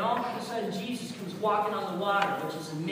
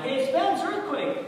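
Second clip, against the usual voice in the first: high-pitched call to a roommate; retold alarm, pitch jumping up; excited alert; 8 semitones higher, 5 dB louder, slower than usual. Student on top bunk to roommate, excited alert.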